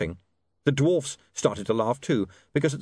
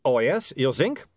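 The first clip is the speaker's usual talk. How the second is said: higher-pitched, testy vampire retort; peeved vampire, mildly angry; snapping accented vampire; 6 semitones higher than usual. A narrator's reading. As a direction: snap sarcastically in a foreign vampire accent. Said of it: "snapped Otto".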